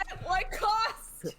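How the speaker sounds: very high, drawn-out melodramatic wail; over-the-top mock anguish, slow; mock tragic. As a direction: stretch it into a theatrical lament. Player repeats mock-tragic line bigger.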